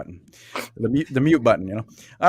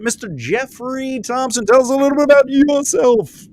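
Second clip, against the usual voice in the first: high, stretched-out guest introduction; announcer introduction; 12 semitones higher, 3 dB louder than usual. Pretend. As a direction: build up the name like a show announcer.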